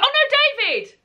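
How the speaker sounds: real high-pitched call to someone off camera. Real, not acted. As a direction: call out in mock dismay, voice leaping up. Calling out to David off camera.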